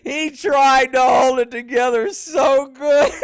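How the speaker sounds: loud, slow, laughing; laughing loudly. Real, not acted. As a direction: push it out through laughter. Still laughing at clip.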